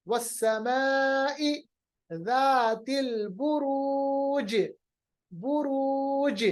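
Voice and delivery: slow measured chant of वस्समाई ज़ातिल बुरूज, j bounced; qalqalah recitation. Shown as a demonstration. Teacher recites the verse, slow chant with echoed बुरूज. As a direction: stretch long vowels, echo the j of बुरूज. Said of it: "बुरूज नहीं पढ़ेंगे".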